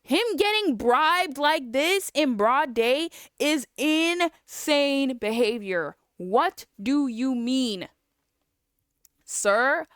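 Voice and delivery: slow, loud, high incredulous rant; loud indignant protest; outraged disbelief. Real, not acted. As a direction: stretch each word out in exasperation. Outraged at the judge's ruling.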